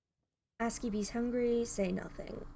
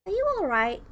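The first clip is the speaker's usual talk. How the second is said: much higher and louder, anxious check-in; concerned character voice; 10 semitones higher, 6 dB louder than usual. Acted. Narrator's heroine checks on the shaken stranger, high and anxious. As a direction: reach up in pitch, worried for him. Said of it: "Love the different voices you're doing".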